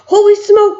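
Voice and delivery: high, loud shocked yell. A narrator's reading. Cook feeling the mouse climb his leg, high loud yell. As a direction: shout it in horror. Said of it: "the man was yelling".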